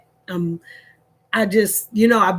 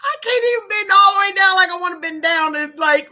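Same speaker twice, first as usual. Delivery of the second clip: ordinary talk, nothing marked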